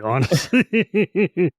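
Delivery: slow, laughing drawl. Real, not acted. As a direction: stretch it out laughing. Drawn out with a laugh.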